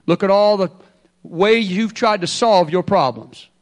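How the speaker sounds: normal talking voice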